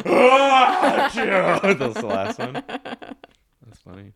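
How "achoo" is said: high laughing achoo shout; shrieking laugh sneeze. Pretend. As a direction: sneeze through a big laugh. Fake sneeze in sneeze joke.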